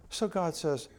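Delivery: ordinary talk, nothing marked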